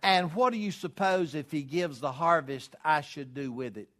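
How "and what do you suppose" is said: ordinary talk, nothing marked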